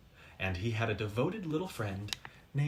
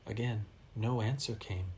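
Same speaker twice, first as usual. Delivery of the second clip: ordinary delivery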